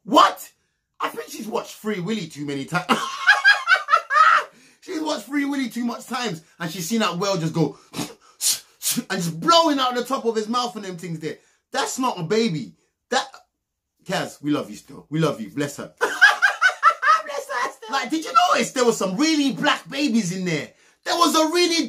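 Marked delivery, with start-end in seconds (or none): 2.9-4.4 a squealing burst of laughter; high shrieking laugh. Real, not acted. Laughing at Free Willy joke. 16.2-17.5 a squealing burst of laughter; high shrieking laugh. Real, not acted. Laughing after "bless her.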